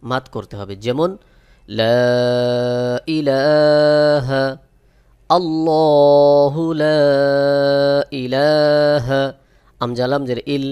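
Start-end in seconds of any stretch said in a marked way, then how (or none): 1.8-9.2 লা stretched for three alif lengths, slow and loud; three-alif madd. Shown as a demonstration. Teacher stretching লা for a three-alif madd. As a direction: hold the লা long, a three-count stretch. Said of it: "তিন আলিফ টান দিতে হবে".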